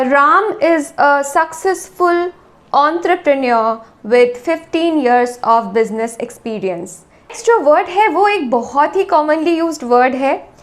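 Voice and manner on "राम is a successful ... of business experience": very slow, deliberate model sentence; slow teacher dictation; slow example sentence. Shown as a demonstration. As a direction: read the example out slowly, word by word. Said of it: "इससे हम क्या sentence बना पाएंगे".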